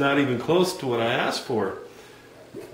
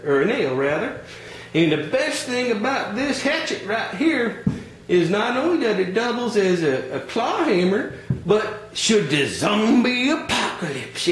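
higher, folksy Southern salesman voice; folksy hardware clerk; 5 semitones higher than usual. Acted. Clerk pushing the wrong tools on a customer, folksy higher voice. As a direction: play the pushy country store clerk.

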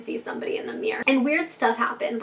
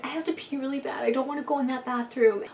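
much softer, small worried voice; scared little plea; 2 semitones higher, 6 dB quieter than usual. Retold or imitated. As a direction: plead quietly, afraid to go. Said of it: "So she's like".

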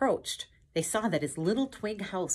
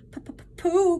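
stammering high cry of alarm; stutter, then squeal the name loudly; Piglet's frightened cry; 13 semitones higher, 7 dB louder than usual. A narrator's reading. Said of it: "cried Piglet".

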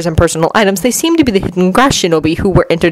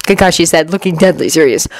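ordinary delivery, nothing marked

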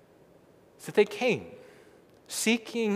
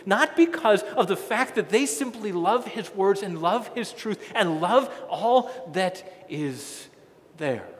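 ordinary delivery

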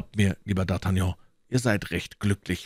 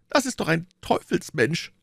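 plain reading voice

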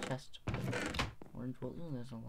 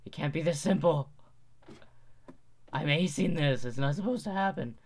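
voice crack; 4 semitones higher, 8 dB louder than usual. Real, not acted. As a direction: voice cracking with excitement. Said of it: "Voice crack, sorry about that".

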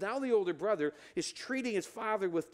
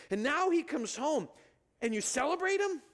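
rising in pitch toward outrage; an aggrieved son confronting his father; indignant older brother; 8 semitones higher than usual. Acted. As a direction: climb higher with each word. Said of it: "Here's what I believe he said".

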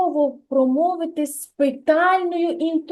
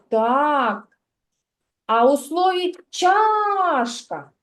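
usual speaking voice

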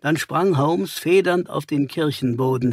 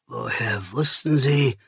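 ordinary delivery, nothing marked

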